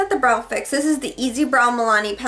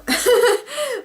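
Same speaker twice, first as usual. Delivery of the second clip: giggle; 11 semitones higher than usual. Real, not acted. Laughs at own uncertainty.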